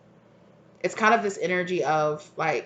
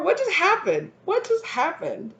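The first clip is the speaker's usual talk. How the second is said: high, stunned "What just happened?"; a lover who just got dumped, asking twice in disbelief; baffled masculine voice; 11 semitones higher than usual. Retold or imitated. The masculine energy, stunned and confused, asking what happened.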